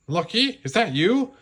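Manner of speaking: high, curious cat voice; a cat calling out in the dark; cat character voice. Acted. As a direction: Sergeant Tibbs, high and surprised. Cat voice in story.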